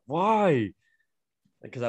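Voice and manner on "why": high-pitched wail of dismay; mock lament. Retold lament over ruined pants.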